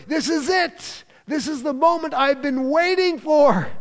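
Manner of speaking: high, excited thoughts of Peter; lightly played eager disciple. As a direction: voice Peter as if his moment has come. Peter's inner thoughts voiced.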